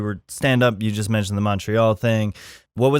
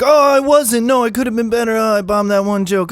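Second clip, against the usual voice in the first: a high, hammed-up falsely modest comic; mock the humble comic, pitched way up; mock self-deprecating whine; 10 semitones higher, 3 dB louder than usual. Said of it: "Don't be like".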